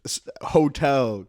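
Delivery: playful fake British intro; mock British accent. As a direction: overdo a cheeky British host. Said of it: "when the British accents come out".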